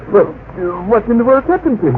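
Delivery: alarmed. Acted. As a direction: blurt it out in shock.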